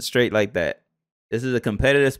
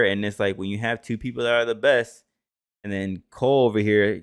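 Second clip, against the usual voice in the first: usual speaking voice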